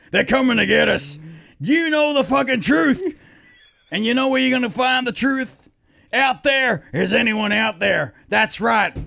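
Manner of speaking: loud, high conspiracy-host tirade; Alex Jones rant. Acted. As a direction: urgently warn listeners of the hidden truth. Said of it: "doing on Alex Jones".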